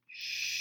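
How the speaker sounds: whispered shh; a child hushing her dad; breathy little shush; daughter's shush. Retold or imitated. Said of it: "She goes".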